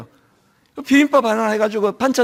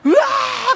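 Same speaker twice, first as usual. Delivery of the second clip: high mock shriek of delight, well above the usual voice; imitated shriek of delight; much slower than usual. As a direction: squeal in imitation of a thrilled congregant. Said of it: "그러고". (흉내 낸 높은 환호성)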